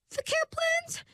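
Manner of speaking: very high, shrill, panicked question; hysterical shriek. Acted. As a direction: shriek it out in disbelief. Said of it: "with a hysterical shrilliness".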